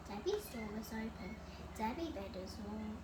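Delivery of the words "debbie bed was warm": ordinary delivery, nothing marked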